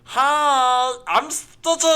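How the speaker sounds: loud, high-pitched cartoon alien voice; Stitch. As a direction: stretch the greeting high, end in a loud cartoon cackle. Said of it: "the other one is Stitch from Lilo and Stitch".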